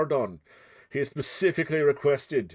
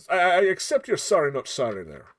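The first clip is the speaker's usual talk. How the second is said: put-on Scottish accent; 5 semitones higher, 5 dB louder than usual. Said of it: "so I can drop this accent".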